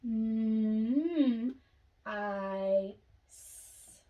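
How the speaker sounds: M, I, S each apart, S breathed; sounding out. Shown as a demonstration. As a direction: break mice into sounds.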